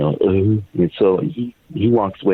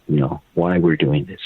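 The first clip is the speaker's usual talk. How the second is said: ordinary talk, nothing marked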